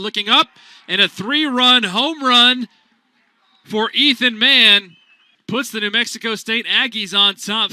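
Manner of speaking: building to a booming home run call. Real, not acted. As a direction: belt out the home run like the crowd just rose.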